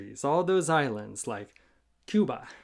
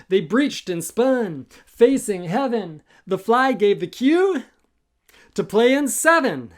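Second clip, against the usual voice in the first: building excitement; 7 semitones higher, 8 dB louder than usual. A narrator's reading. Story builds to the cue.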